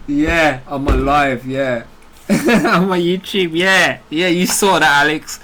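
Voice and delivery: loud, high laughing jeer; triumphant mocking crow; gloating taunt. Real, not acted. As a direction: rub it in his face. Taunting Alex after report.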